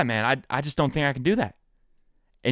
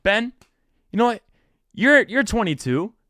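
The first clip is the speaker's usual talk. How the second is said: mock the people who doubt him; a light higher take on viewers egging him on; imitating doubters; 7 semitones higher than usual. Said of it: "everyone's probably thinking".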